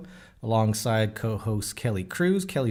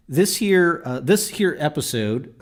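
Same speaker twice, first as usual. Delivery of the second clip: put-on Texan accent; 5 semitones higher, 6 dB louder than usual. Host introducing the episode in a hint of a Texan accent. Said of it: "I'm talking like a little bit of a little Texan here".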